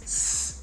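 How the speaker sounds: s as a soft voiceless hiss; just hiss the s, no voice; hissing s sound. Shown as a demonstration. Teacher hissing s to contrast it. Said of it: "o outro é s".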